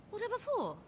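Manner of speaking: surprised. Acted. Startled guard at the visitor.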